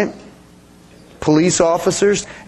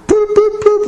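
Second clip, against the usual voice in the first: high, even boops like an alarm; warning beeps; 4 dB louder than usual. Retold or imitated. Preacher mimicking a car's dashboard warning light, high-pitched beeping.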